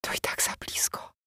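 very soft, voiceless whisper; frightened whisper. A narrator's reading. Terrified wife judging the grave too near, whispering. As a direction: whisper it in dread. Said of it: "wyszeptała wciąż przerażona Rina".

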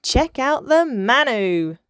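loud, high, stretched-out call; excited yell. A narrator's reading. Boy dolphin announces his splash move, yelling. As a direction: yell it out, showing off. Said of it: "yelled little Hector".

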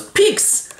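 very high squeak; tiny piercing jab noise; imitated sword stab. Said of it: "And Laertes goes".

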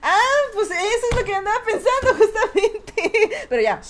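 loud, high words through laughter; laughing hard while talking. Real, not acted. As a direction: caught out by the chat, giggling. Laughing at chat guess.

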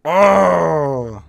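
long, loud, falling groan; loud despairing wail. Real, not acted. Player despairing after losing, long loud wail. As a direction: wail it out in defeat.

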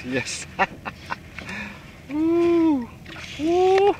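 normal talking voice

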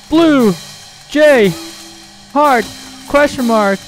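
usual speaking voice

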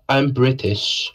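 slow, hammed-up British accent; mock British accent. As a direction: put on a jokey British voice for your co-caster. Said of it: "I'm gonna start talking like".

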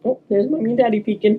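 usual speaking voice

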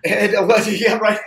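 high, loud laughter; laughing. Real, not acted. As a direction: burst out laughing at the joke. Laughs at joke about money.

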